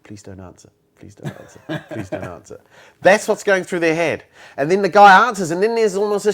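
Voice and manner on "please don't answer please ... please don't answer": soft mock pleading, half laughing; anxious inner plea. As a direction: murmur the hope nobody picks up, giggling. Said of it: "most people are thinking".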